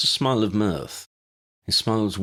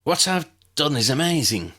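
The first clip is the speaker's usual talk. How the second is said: proud, raised announcement; announced with pride; 8 semitones higher, 3 dB louder than usual. A narrator's reading. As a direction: proclaim it like a triumph. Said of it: "he announced with pride".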